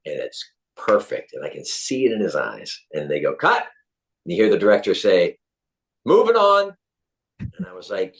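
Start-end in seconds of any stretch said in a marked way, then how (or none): 3.3-3.7 high-pitched call of a director; director's call. Retold or imitated. Retold director calling on set. 6.1-6.8 high, loud, sustained call; director's call. Retold or imitated. Retold director calling out on set.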